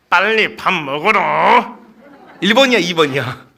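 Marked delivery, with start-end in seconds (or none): 0.1-1.8 drawn-out, nagging order; impatient mother. Shown as a demonstration. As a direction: order the child to the table, stretching the words.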